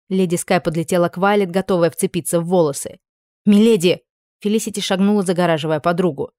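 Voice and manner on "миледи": loud alarmed call; warning cry. A narrator's reading. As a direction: call out to stop her. Maid cries out warning.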